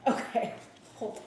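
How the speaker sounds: talking while holding breath; squeezed out on held breath. Real, not acted. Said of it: "I'm holding my breath right now".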